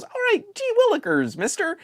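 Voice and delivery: put-on high, fast gee-whiz kid voice; eager little boy from an old sitcom; wholesome 1950s kid. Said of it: "he goes".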